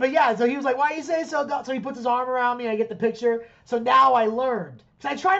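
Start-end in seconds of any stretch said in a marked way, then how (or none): 0.8-1.7 high-pitched friendly question, retold; exuberant welcome. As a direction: imitate a star suddenly warming to a fan. Repeats the star's suddenly friendly welcome.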